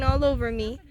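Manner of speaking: ordinary delivery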